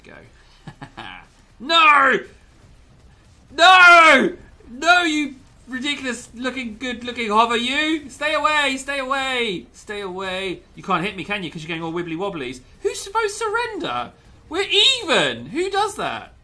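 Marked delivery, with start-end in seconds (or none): 1.6-10.6 high, loud frantic shouts; laughing panic shout; panicked yelling. Real, not acted. Enemy hover chasing him. 12.8-16.3 high, loud disbelief; shocked, squealing disbelief; incredulous exclaim. Real, not acted. Enemy surrenders unexpectedly.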